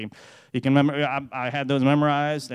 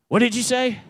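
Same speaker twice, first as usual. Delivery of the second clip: excited question; 9 semitones higher, 7 dB louder than usual. Retold or imitated. Dying dad reacting to verse.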